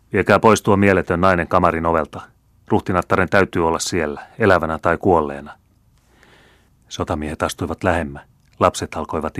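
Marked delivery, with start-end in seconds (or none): none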